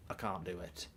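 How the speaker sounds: ordinary delivery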